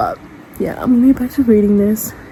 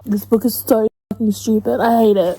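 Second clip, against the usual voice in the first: ordinary talk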